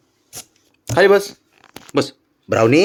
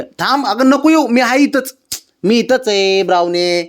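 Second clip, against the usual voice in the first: high, raised call to a dog; calling out reassurance; 10 semitones higher than usual. Real, not acted. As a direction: call out to stop the dog following. Telling dog not to follow.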